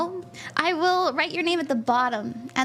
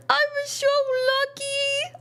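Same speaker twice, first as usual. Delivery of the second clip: retelling tearful gratitude; 11 semitones higher, slower than usual. Retold or imitated. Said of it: "I was like".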